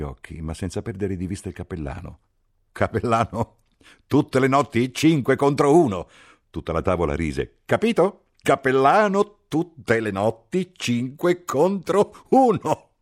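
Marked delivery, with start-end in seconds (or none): 2.7-6.1 much higher and louder, jeering at the chaplain; a mocking officer ribbing the priest; teasing captain. A narrator's reading. 7.6-12.9 much higher, louder and slow, drawn-out jeer; mocking repeat. A narrator's reading. Captain repeats the joke, slower.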